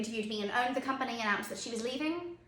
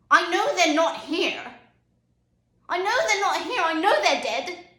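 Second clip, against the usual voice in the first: loud, high, mounting insistence; anguished insistence; 6 semitones higher, 7 dB louder than usual. Acted. Bereaved parent insisting they know the truth, anguished. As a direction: insist forcefully, pitch climbing on the repeat. Insisting, admitting children are dead.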